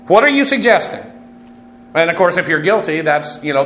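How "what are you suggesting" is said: higher-pitched, mildly indignant question; defensive retort. Acted. Guilty man answering his accuser, defensive and higher-pitched. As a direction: push back as if accused. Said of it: "how very often guilty people will, will react".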